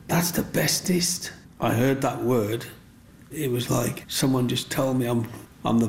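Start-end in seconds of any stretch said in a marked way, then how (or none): 0.1-1.3 put-on high slow breathy child voice; little girl praise. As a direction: whisper it like a delighted little girl. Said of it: "And she said".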